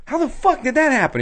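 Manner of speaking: hammed-up high confusion; high baffled outburst. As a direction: yelp it in disbelief. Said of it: "they were like".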